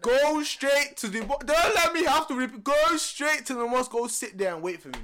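loud, high, stern dad order; angry dad. Retold or imitated. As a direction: bark orders like a furious father. Angry dad on phone quoted.

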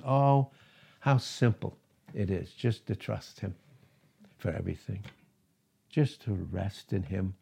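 soft, low, with long pauses; tender awe. Real, not acted. Preacher urging simple trust and rest, tenderly. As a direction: marvel gently, dropping low and quiet.

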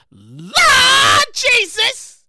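loud, high, hugely stretched "Lord"; hammed-up exclamation. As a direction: wail it like an overwhelmed churchgoer. Mock horror at stolen pricey meat.

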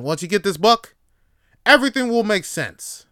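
high, loud guru promise; mock self-help guru; sales pitch. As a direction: promise the world like a TV huckster.

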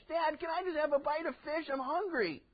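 put-on high child's plea; lightly voiced begging kid; child asking. Preacher voicing a child asking dad for fish, high and pleading.

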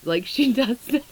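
ordinary talk, nothing marked

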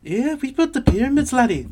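high, hammed-up Irish accent. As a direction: ham up a bad Irish brogue. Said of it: "I can't do an Irish accent very well".

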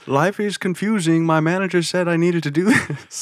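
high-pitched mock confused athlete; hammed-up high voice, breaking into laughter; Michael Jordan. Athlete blaming his manager, high mock confusion. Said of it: "Michael, you're wearing".

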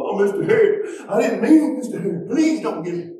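high, whining mock teenager plea; hammed-up imitation of a begging pupil; pleading student. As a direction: beg teacher like a caught teen. Acting students pleading for phones.

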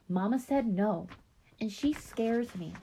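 put-on slow, small high little-girl voice; timid little child. A narrator's reading. Five-year-old afraid of Mama refuses the park, timid. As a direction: protest meekly as a small child.

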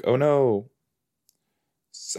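ordinary delivery

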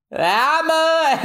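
high yelled hammer; high cartoon shout; high attack cry. Voicing Usopp's attack call.

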